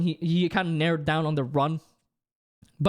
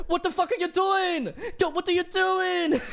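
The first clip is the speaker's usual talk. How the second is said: high, giggly attempt at a German accent; failed mock-German exclamation through laughter; bad German accent; 11 semitones higher than usual. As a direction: imitate a German badly, voice pitched way up. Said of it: "how to do a German accent".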